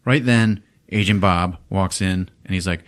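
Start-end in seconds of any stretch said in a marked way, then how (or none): none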